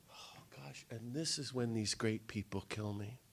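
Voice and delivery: hushed, soft whisper; breathy confiding aside. Real, not acted. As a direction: lean in and whisper it. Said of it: "whispered aside".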